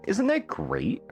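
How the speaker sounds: usual speaking voice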